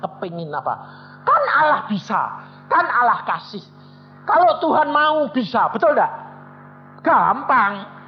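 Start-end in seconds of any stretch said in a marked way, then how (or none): 1.3-8.0 high, loud pulpit preaching; fiery sermon voice; full-force preaching. Acted. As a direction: preach it hard to the whole room.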